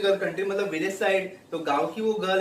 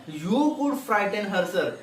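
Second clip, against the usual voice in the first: slow read-aloud; 2 semitones higher, much slower than usual. Acted. Teacher reads steward's flattery.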